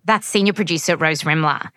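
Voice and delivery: ordinary delivery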